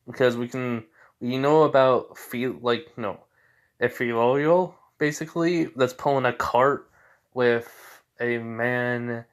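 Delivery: usual speaking voice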